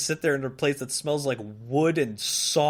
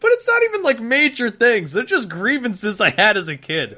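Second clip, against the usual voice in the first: high, loud indignant protest; worked up; 6 semitones higher, 5 dB louder than usual. Real, not acted. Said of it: "when you're getting upset at things".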